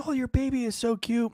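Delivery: put-on high cooing old lady; super old lady. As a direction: imitate an old lady fussing over a baby. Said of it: "this super old lady".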